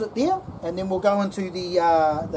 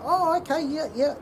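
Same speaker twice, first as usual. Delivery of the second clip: casual friendly chat, higher; 8 semitones higher than usual. Shown as a demonstration. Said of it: "you go".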